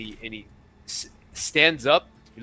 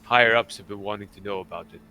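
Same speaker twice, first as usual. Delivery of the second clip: measured, slightly lowered captain voice; retired pirate captain; 3 semitones lower than usual. Acted. Captain briefing adventurers on a missing scroll, measured character voice. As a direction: confide the job with pauses, sinking at phrase ends.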